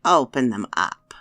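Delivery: loud, sharp witch order; crone command. Acted. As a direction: snap the order like a hag. Old woman orders the girl.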